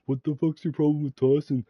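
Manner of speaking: high, loud, mocking aggressive question; mock angry friend. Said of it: "was like".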